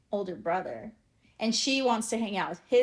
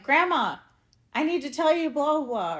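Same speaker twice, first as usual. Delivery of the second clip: calling out Grandma; 6 semitones higher than usual. Retold or imitated. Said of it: "'Cause I'm like".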